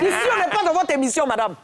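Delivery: angry retort. Retold or imitated. Telling off the syndic president, fast and angry. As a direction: fire it back at her, fast and loud. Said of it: "vous criez comme ça".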